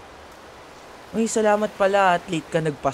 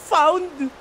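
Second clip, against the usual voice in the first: mocking disbelief; 11 semitones higher, 4 dB louder than usual. Mocking the wrong word.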